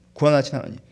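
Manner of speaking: usual speaking voice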